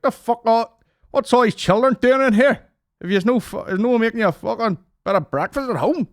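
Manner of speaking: high, loud, put-on angry voice; mock outraged rant. Himself at McDonald's, ranting at the children, in a high mock-outraged voice. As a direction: squawk it out in a shrill mock rage.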